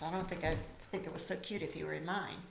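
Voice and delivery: ordinary delivery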